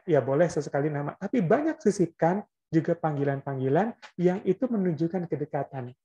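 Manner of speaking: normal talking voice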